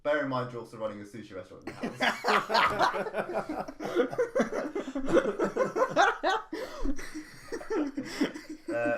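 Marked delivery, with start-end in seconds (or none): 1.8-8.7 long, high-pitched laughing fit; cracking up completely; helpless laughing. Real, not acted. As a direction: laugh on and on, unable to stop. Cracking up at the riddle.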